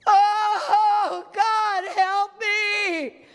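high, wailing plea for help; acted desperate cry. Earthquake victim calling on God, desperate wail. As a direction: cry out to God as if disaster has struck.

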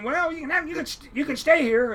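high-pitched imitation of the captain; imitated squeaky voice, relenting; squeaky little voice. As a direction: grant it in a squeaky falsetto. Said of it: "he has the squeakiest little voice".

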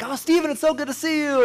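high, chirpy family hello; relative greeting. Retold or imitated. Relative on a Christmas FaceTime call, high cheerful hello. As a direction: greet him brightly on the call. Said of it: "it's like".

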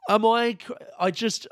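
usual speaking voice

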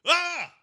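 imitated yelp. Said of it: "wouldn't, like".

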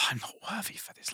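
soft unvoiced whisper of doubt; whispered self-doubt. Host voicing a listener's doubt, whispered. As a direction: breathe it like a private worry.

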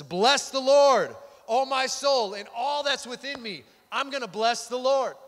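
high, emphatic declaration of praise; passionate praise. Acted. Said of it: "That sounds like passionate praise and worship".

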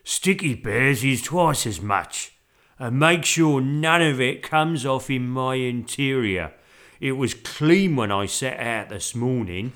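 loud, slow, grumbling driver; growling cabbie. A narrator's reading. Taxi driver warning sticky bear, growling. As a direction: grumble loudly, dragging the words. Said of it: "he growled".

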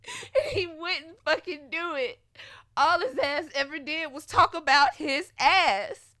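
loud, high, laughing-crying complaint; laughing while upset. Real, not acted. Telling viewers about his selfishness, loud laughing complaint. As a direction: laugh through the frustration.

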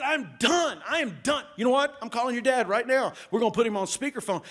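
high, loud threat to call his dad; angry threat. Retold or imitated. Pastor threatening to call the boy's dad, loud and angry. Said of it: "I was going off".

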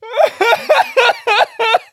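loud, high, pulsing sobs; rhythmic high wailing, put on; demonstrated crying. Shown as a demonstration. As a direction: act out your own full bawl. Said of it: "that's how I sound when I cry".

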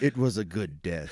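low, grave film-narrator line; deep solemn movie voice. Retold or imitated. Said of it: "quoting the film".

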